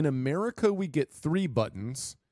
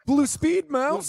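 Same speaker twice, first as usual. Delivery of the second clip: teasing; 12 semitones higher, 7 dB louder, slower than usual. Acted. Teasing co-host about Sonic.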